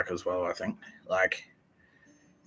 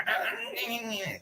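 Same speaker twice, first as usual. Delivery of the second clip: very high, softer whiny noises; mock fussing; 5 dB quieter than usual. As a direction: imitate a fretting nag, squeaking wordlessly. Mocking the agitated housemate's fussing.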